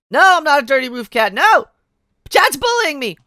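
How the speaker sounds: high, loud whining protest; mock whiny protest. Streamer protesting chat's teasing in a high, loud mock whine. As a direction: whine like a wronged kid.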